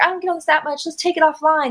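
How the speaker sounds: retold app match. Match asking women to go offline, lightly mimicked. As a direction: lightly imitate a casual match. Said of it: "this is what the women are telling me".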